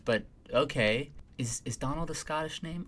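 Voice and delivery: normal talking voice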